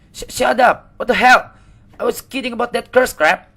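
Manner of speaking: scared yell. Acted. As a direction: snap back in a frightened shout.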